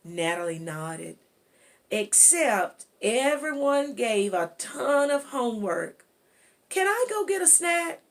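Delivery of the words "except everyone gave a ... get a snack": put-on higher, louder, eager child's question; girl asking mom. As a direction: ask for the snack like an eager kid. Natalie asks her mom.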